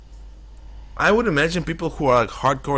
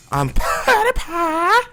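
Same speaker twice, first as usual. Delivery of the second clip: very high, slow, squeaky voice; PewDiePie impression; 3 dB louder, much slower than usual. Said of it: "I can always copy PewDiePie".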